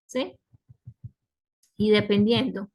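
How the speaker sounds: ordinary delivery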